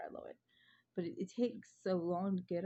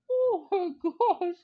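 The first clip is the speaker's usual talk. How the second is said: high, loud, slow laughing cry; laughing exclaim; 11 semitones higher, 11 dB louder, much slower than usual. Retold or imitated. Retelling the puppy mess, laughing hard. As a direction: burst out laughing through it.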